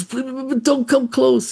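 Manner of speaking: high, loud panicked plea; afraid. Acted. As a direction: beg him frantically to keep away. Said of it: "He's afraid he's gonna smell it".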